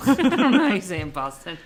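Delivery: laughing. Real, not acted.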